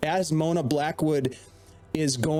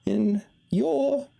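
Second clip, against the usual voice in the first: Mona's voice. Acted. GM voices Mona parting.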